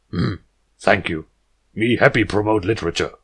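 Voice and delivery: chest-thumping jungle hero, terse and loud; jungle stallion talk. Acted. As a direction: puff up and speak in blunt caveman words. Balrus thanks her.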